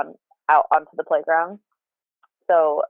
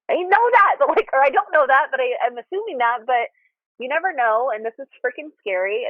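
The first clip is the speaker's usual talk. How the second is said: anxious, high-pitched; 8 semitones higher than usual. Retold or imitated. Retold frightened outburst to husband.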